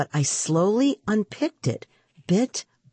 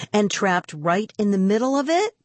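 ordinary delivery, nothing marked

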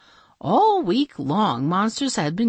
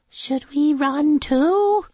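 chirpy princess; 10 semitones higher, 3 dB louder, slower than usual. A narrator's reading. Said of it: "said Princess Sneezewort".

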